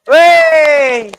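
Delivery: high, loud gleeful "whee"; playful whee. Real, not acted. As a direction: let out a giddy high squeal of fun. Playful cheer at the audience.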